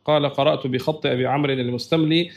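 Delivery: normal talking voice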